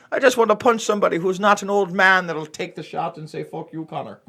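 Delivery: fake-sorry plea in a hammed-up Irish accent; mock Irish accent. Fighter pleading to Dana on TV in mock Irish accent. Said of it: "my hackneyed Irish accent".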